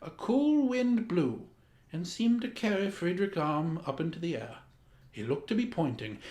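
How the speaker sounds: usual speaking voice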